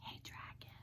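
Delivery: soft breathy whisper. Acted. Elizabeth whispering to the sleeping dragon, soft. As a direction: whisper so he won't wake. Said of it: "whispered very softly".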